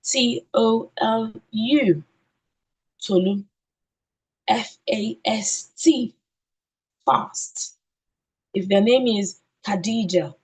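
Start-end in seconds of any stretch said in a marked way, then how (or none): 0.0-3.4 slow "T O L U", grouped by syllable, then "Tolu"; spelled by syllables. Shown as a demonstration. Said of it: "and then spell according to syllables". 4.5-7.8 slow, measured "F A S T", pause, then "fast"; spelled out slowly. Shown as a demonstration. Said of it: "and then spell according to syllables".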